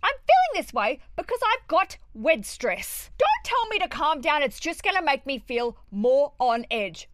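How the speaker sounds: exasperated explaining of wed stress; stressed outburst. Retold or imitated. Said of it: "And you can just go".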